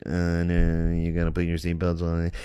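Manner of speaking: lightly mimicked droning airline announcer; mock an annoying cabin announcement. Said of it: "It's so annoying. They're like".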